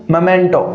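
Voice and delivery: मोमेंटो as mə-MEN-to, short first vowel; firm model of मोमेंटो; correct pronunciation. Shown as a demonstration. Teacher tells students how to say it from now. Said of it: "आज के बाद में आपको इसका उच्चारण क्या करना है".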